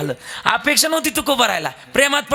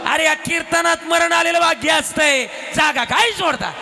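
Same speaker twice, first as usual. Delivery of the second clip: Tukaram shouting; 6 semitones higher than usual. Acted. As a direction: yell after the fleeing crowd to hold them. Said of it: "Tukaram Maharaj ओरडले मागून".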